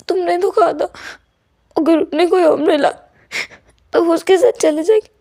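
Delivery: hurt and close to tears, quoting her back; tearful pleading. Acted. Wing tearfully reminds Vanching of her words, voice high. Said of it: "wing की आंखों में आंसू आ गए थे".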